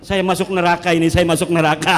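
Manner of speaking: fast, loud, mocking a jittery Wahabi; hammed-up fear of hellfire, blurted quickly; mock trembling panic. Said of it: "dia ngegeter-geter". (tiruan panik gemetar yang dibuat-buat)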